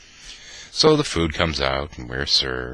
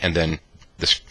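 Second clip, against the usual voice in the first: plain reading voice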